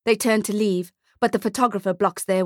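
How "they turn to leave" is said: ordinary delivery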